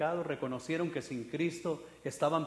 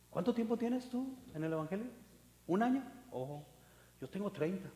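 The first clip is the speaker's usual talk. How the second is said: put-on smug old-timer; 3 semitones higher, 6 dB quieter than usual. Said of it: "Empiezan a sentirse como que".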